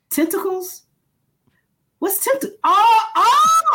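high puzzled voice breaking into a laughing shout; mock the coordinator's dawning realization; confused then realizing. Coordinator catching the word mix-up, high and laughing. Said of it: "And he's like".

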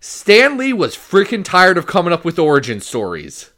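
loud and high, fed up; exasperated exclamation. Real, not acted. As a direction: blurt the punchline out loud.

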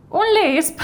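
high, incredulous "¿Un lisp?"; amused surprise at the question; surprised echo. Acted. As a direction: toss back the word in disbelief. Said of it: "Does she have a lisp?".

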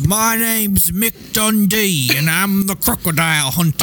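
put-on drawn-out cheesy Crocodile Dundee accent; mock Australian. Introducing himself as Mick Dundee, slow hammed-up Australian accent. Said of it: "making fun of someone dressed like Crocodile Dundee".

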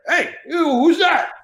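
high put-on gruff De Niro. De Niro calling from the next villa, a put-on voice. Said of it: "And I hear".

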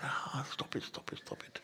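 pleading to stop in a whisper; tormented mind whispering to itself; whispered plea. Acted. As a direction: beg the inner voice to stop, under your breath.

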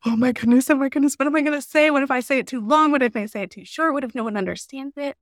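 nervous networker dreading her fifteen-second intro; freaking out. Retold or imitated. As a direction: spiral into nervous panic, fast. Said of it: "freak out and be like".

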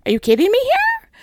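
you can't believe this driver; exasperated disbelief. Retold or imitated. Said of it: "I'm trying not to be like".

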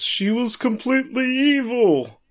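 high, loud, drawn-out outburst; acted yell. Prosecutor loses his cool over his lover, high mock yell. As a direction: imitate a man losing his cool and yelling. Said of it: "he yells".

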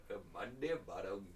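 soft, low mock Indian accent. Host echoing the Indian creature's name, soft Indian accent. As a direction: say it quietly with a put-on Indian accent.

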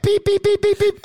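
very high, loud censor beeps; yelled censor beeps. Pretend. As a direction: shriek the bleeps out like a censor tone. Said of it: "I did the beeping for you".

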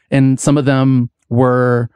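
usual speaking voice

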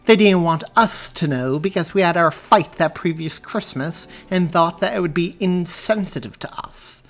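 high, loud snide telling; sarcastic reveal. A narrator's reading. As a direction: spill the secret with relish. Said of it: "Mary asked sarcastically".

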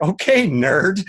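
high, slow teasing drawl; slow mocking put-down; mock friend's reply. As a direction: tease him like a nerd. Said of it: "she'd be like".

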